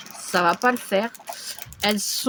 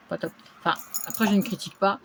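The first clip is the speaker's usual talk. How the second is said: ordinary delivery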